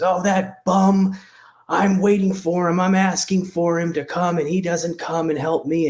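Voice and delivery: high-pitched, exasperated griping; crude man yelling. Acted. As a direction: imitate a crude guy cursing about someone who let him down. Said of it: "cursing and he's yelling".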